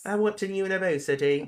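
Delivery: posh British voice; British accent. Pretend. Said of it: "I don't know why I did a British accent".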